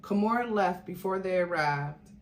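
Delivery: usual speaking voice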